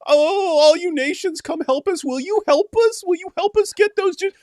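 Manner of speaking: high-pitched mock whining for help; whiny beggar. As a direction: whine it like a sobbing child begging. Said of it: "endless whining and crying".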